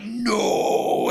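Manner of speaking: very high, stretched-out "no"; mock dismayed cry. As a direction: wail it in exaggerated dismay. Mock dismay as the ball flies off.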